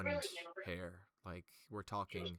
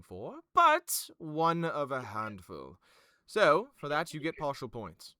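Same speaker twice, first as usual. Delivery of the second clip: grade her like a pet; patronizing nobleman; 2 semitones higher, 9 dB louder than usual. Acted. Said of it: "sits there and goes".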